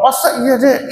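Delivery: can't believe the amount; husband stunned by a million rupiah; surprised husband. Retold or imitated.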